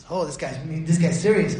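normal talking voice

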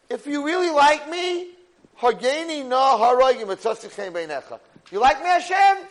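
high, loud, impassioned begging; desperate plea. Retold or imitated. Moshe begs Hashem for a favor, desperate loud plea. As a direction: plead at full voice with God.

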